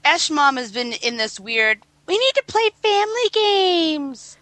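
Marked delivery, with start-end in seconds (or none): 2.1-4.4 much higher, put-on chirpy mom; a high, mocking imitation of the mom. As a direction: parody mom's family-games push. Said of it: "mode lately".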